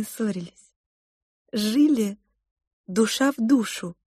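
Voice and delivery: wistful, mildly incredulous; lightly voiced cook mother. Mother to the children about her husband, lightly wistful.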